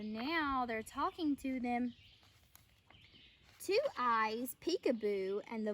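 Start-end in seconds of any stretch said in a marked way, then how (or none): none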